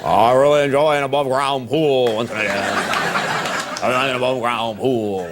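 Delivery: N=Tom Brokaw/public; Brokaw impression. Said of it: "How he talks".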